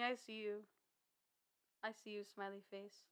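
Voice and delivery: ordinary talk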